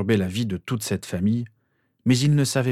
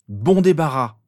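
higher, louder parting jab; angry dismissal; 5 semitones higher, 5 dB louder, slower than usual. A narrator's reading. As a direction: fling it at a rival you want gone. Parting jab in acted quarrel.

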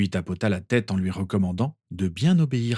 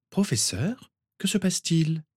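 put-on higher, anxious girl's voice; worried Lily; 8 semitones higher than usual. A narrator's reading. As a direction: voice Lily's worry, higher. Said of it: "s'inquiéta Lily". (voix de Lily inquiète, plus aiguë)

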